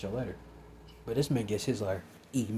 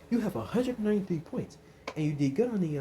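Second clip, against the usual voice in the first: higher, brighter principal voice; Nezu's voice; 8 semitones higher, 3 dB louder than usual. Acted. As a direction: play the kind principal, pitched up. Nezu voiced in retold story.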